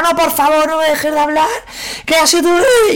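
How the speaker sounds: very high, whiny begging; mock pleading. To the speaker who blocks her, very high whiny pleading. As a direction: beg him not to stop talking. Girl begging him to keep talking.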